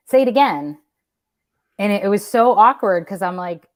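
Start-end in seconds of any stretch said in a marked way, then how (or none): 0.1-0.8 blunt demand. Retold or imitated. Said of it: "And they're like".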